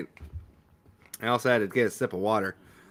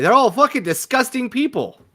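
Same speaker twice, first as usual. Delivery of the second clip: much higher, louder disgusted rant; angry outburst; 11 semitones higher, 6 dB louder than usual. Real, not acted. Streamer ranting about the filthy family, loud and disgusted. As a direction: spit it out in disgust.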